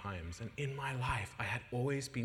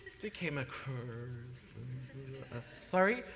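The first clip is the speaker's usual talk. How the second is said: hesitant confession; 3 semitones higher than usual. Retold or imitated. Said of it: "It's like".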